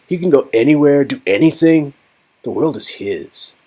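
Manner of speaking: earnest young man in awe, lightly voiced; a man lost in wonder before a painting; [name] admiring painting.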